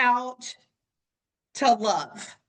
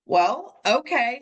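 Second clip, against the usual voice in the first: ordinary delivery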